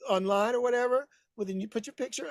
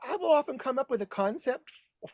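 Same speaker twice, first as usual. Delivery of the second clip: ordinary delivery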